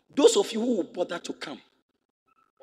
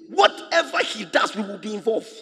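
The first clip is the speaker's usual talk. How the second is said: preaching at full force; 4 semitones higher, 7 dB louder than usual. Real, not acted.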